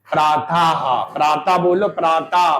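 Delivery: प्रातः ending in a breathy हा; teacher shows the visarga sound; visarga demo. Shown as a demonstration. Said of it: "बाद में हा निकल रहा".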